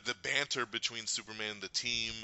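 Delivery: normal talking voice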